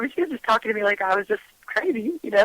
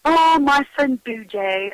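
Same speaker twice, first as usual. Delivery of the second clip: put-on drawn-out, higher little-kid answer; voicing small child; 4 semitones higher, 3 dB louder, slower than usual. Three-year-old telling mom about her friend, childlike. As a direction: imitate a three-year-old, slow and singsong. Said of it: "She was three years old".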